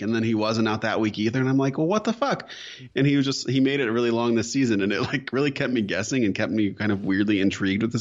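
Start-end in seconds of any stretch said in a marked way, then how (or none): 1.8-2.5 high-pitched disbelief; incredulous. Retold or imitated. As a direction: squeal it in disbelief. Retold frustrated thought.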